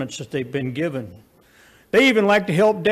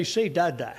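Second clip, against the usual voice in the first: put-on softer, gentle coaxing to a baby with a little laugh; soft coaxing baby talk; 3 semitones lower, 6 dB quieter than usual. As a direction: coax the baby sweetly, almost a whisper. Daddy coaxing baby to speak.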